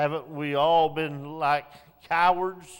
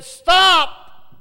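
1 shouted stop; 13 semitones higher, 12 dB louder than usual. Retold or imitated. Man shouts at soldiers in dream.

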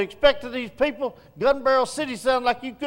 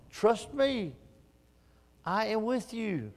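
soft voice of God; 9 dB quieter than usual. Acted. God answers preacher in prayer.

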